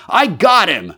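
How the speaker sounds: high, loud cry of triumph; shouted. A narrator's reading. Jaffers grappling with his unseen enemy, shouting. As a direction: shout it out over a struggle. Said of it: "shouted Jaffers".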